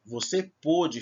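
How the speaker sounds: pôde with a closed O, said slowly. Shown as a demonstration. Teacher using the past tense, slow pôde with closed O. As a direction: round and close the stressed vowel of pôde. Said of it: "é diferente".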